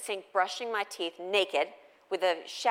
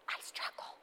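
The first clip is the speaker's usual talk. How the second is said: whispered confession; hushed, breathy admission; 13 dB quieter than usual. Real, not acted. Mother confiding to the audience in a whisper. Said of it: "whispered confession".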